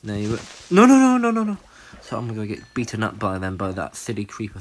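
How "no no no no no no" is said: high, loud, panicky string of refusals; alarmed. Real, not acted. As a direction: cry out as danger closes in. Sudden alarm at a creeper.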